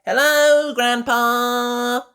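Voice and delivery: high, loud little piglet shout; shouting Peppa. A narrator's reading. Peppa calls out to Grandpa across the garden, loud and high. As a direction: call out to Grandpa like an excited small girl. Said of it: "shouts Peppa".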